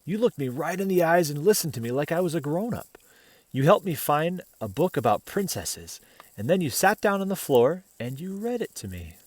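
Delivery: put-on gentle read-aloud voice for children; picture book reading to kids; storybook narration. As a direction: tell it like a bedtime story.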